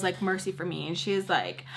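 ordinary delivery

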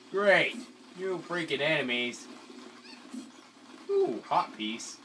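ordinary talk

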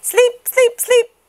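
put-on very high, loud baby voices; sleepy baby chant; sleepy babies. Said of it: "finally whispered".

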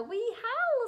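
high-pitched playful howl; storyteller's small wolf howl; little werewolves howling. A narrator's reading.

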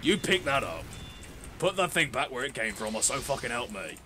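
lightly voiced scolding mate; a hint of a cartoon scold; put-on scolding bloke. Gamer scolding the enemy in a lightly put-on voice.

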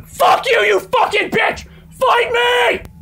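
high, loud screamed challenge; furious scream. Real, not acted. Streamer challenging the game's monster to fight, furious scream. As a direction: scream defiance at the attacker.

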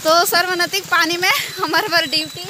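ordinary talk, nothing marked

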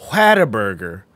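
low Whataburger, first vowel flat as in hat; mocked pronunciation. Mocking co-host's way of saying it, low exaggerated Whataburger. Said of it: "that's not how you say those words ever".

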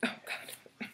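hushed oh god; soft whispered wonder; awed whisper. Real, not acted. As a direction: whisper in awe. Whispered awe.

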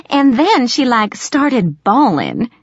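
catty gossip. Acted. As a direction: savor the mean gossip. Angie gossiping mockingly.

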